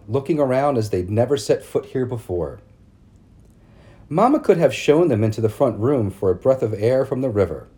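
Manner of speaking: ordinary delivery, nothing marked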